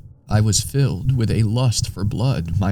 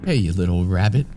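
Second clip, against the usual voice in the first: plain reading voice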